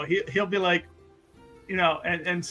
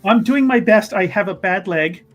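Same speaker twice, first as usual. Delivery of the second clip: protesting escort; 3 semitones higher, 8 dB louder than usual. Acted. Limping host pleading with an impatient lord, loud protest. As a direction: protest as you struggle to keep up.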